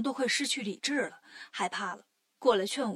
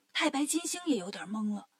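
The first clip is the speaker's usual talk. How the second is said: plain reading voice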